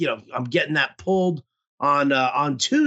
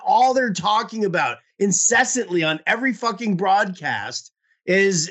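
usual speaking voice

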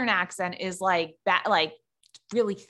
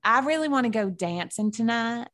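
Southern accent; 2 semitones lower than usual. Shown as a demonstration. Co-host proving the Southern accent works, Southern accent. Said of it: "I have a really good one".

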